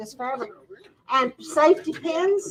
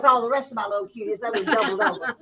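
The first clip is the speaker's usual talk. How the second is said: ordinary delivery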